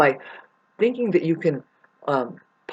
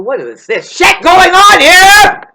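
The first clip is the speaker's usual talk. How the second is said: furious goddess; 12 semitones higher, 14 dB louder than usual. Acted. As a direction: thunder down on them as the angry creator. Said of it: "summon the oceans up and say".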